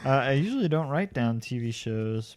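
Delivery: ordinary talk, nothing marked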